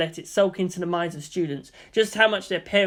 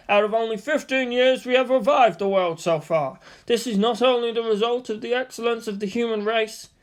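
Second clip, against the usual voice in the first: teacher lecturing; 5 semitones higher than usual. A narrator's reading. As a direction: recite history to a class.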